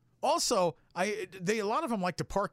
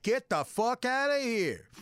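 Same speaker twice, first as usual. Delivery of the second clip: gruff manager; 7 semitones higher, slower than usual. Retold or imitated. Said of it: "And he's like".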